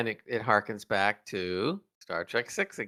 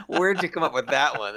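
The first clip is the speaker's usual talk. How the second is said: asked through a laugh; laughing question; 4 semitones higher than usual. Retold or imitated. Podcast host laughing at a clever line. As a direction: chuckle the words out, amused. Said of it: "I'm going like".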